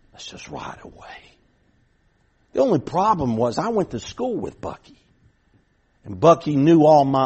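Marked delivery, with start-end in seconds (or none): 0.1-1.2 whispered, hushed urging; conspiratorial whisper. Retold or imitated. Friend whispering to the narrator to sneak away, hushed urging. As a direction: tempt him quietly to slip off.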